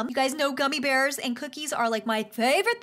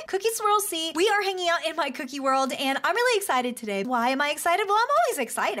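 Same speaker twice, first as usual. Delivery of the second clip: usual speaking voice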